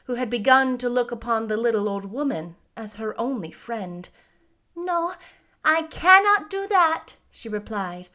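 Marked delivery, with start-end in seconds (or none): 4.7-7.2 put-on high old lady voice, firm; old witch. A narrator's reading. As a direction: refuse the child as a kindly witch. Old witch refuses the girl.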